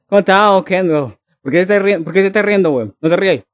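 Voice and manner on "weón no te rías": usual speaking voice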